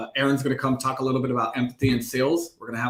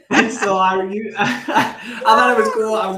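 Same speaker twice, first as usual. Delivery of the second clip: laughing through the words, higher and louder; 9 semitones higher, 8 dB louder than usual. Real, not acted. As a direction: chuckle it out, pleased with himself. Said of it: "laughs while talking".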